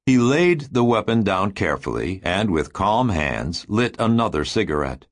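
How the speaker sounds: plain reading voice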